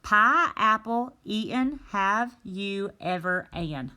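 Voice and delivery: a teacher slowly reading a jumbled sentence; reading the scrambled words. Shown as a demonstration. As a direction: read the jumbled words out deliberately. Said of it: "go down to the next one".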